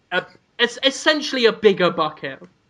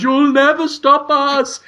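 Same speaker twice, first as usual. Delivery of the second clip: high, slow, drawn-out creature taunt; cancer monster; 6 semitones higher, slower than usual. Acted. Cancer taunts its captors from a shaking bucket, monster voice. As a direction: stretch it out as a trapped monster's defiant cry. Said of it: "and it goes".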